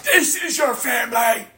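high shout as Satan's taunt; Satan's scream. Retold or imitated. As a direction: yell it like the devil jeering. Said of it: "Satan, is screaming at you".

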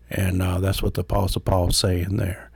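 normal talking voice

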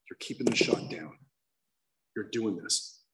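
low, hushed talk to himself in the mirror; quiet self-pep talk. Retold or imitated. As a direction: murmur encouragement to your reflection. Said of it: "I'd be like".

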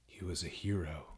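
soft, low whisper; mock-solemn hush. Co-host echoes in a hush.

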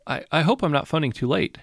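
夜中の電話で不安げに; nervous. Acted. Nervous caller at night.

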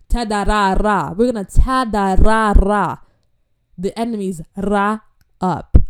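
loud, hammed-up war chant of nonsense syllables; preacher replaying her bold declaration about the enemy, loud and playful; mock battle cry. Shout the ta-da-ra-ra like a charge.

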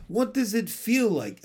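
lightly voiced intrigued monarch; curious king. A narrator's reading. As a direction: ask with keen curiosity. Curious king questions cow.

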